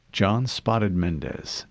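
plain reading voice

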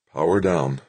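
plain reading voice

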